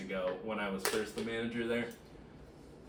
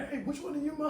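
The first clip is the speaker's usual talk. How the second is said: high-pitched angry customer mock-up; mock yelling customer; 4 dB louder, faster than usual. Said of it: "if you start yelling".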